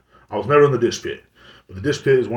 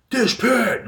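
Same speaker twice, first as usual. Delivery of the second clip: mock yell, much higher; imitating a yell; 12 semitones higher than usual. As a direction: bark "Dish pit!" across the kitchen in a high mock shout. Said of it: "he was all screaming".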